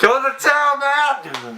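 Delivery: very high, loud yell; mock getaway yell. Friend joining the joke getaway, very high yelled. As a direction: shout like a fleeing outlaw.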